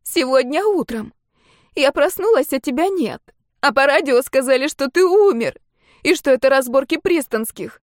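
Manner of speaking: high, loud distraught wailing; upset, high-pitched lament; through tears. A narrator's reading. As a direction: pour it out tearfully at a high pitch. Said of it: "проговорила сквозь очередную порцию слёз".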